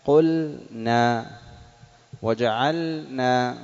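قلنا and وجعلنا said very slowly, the lam held clear before the nun; drawn-out recitation. Shown as a demonstration. Teacher demonstrating the clear lam before nun, very slow. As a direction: stretch each word out and keep the lam distinct. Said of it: "نحو".

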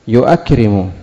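يؤكرم as "yu-akrimu", hamza fully sounded; hamza kept in يؤكرم. Shown as a demonstration. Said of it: "لم يحذف شيء". (يؤكرم بنطق الهمزة المفتوحة كاملة)

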